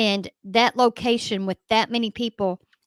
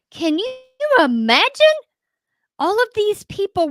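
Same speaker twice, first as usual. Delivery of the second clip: incredulous; 11 semitones higher, 6 dB louder than usual. Real, not acted. Disbelief at the crowd.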